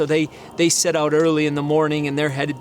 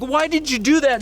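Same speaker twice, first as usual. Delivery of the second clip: high, raised angry shout at the donkey; angry yell; 9 semitones higher than usual. Retold or imitated. Balaam yelling at his donkey, high angry shout.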